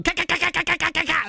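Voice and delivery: mock straight-pipe backfire rattle; imitated exhaust popping. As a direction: spit out quick staccato kas like a straight pipe. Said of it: "blaring my eardrums out going".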